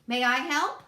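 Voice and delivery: keen little rabbit offering help; bright eager request. A narrator's reading. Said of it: "he asked eagerly".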